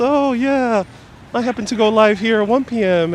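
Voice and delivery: high, put-on casual surprise; sarcastic imitation of a clueless streamer; mock innocence. Mocks streamers' supposed coincidences.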